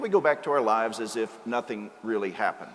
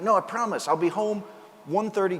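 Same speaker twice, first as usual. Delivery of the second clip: higher, eager husband promising to be home; pleading husband; 8 semitones higher, 3 dB louder than usual. Retold or imitated. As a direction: beg her to say yes, voice lifted high. Husband pleads with doubtful wife.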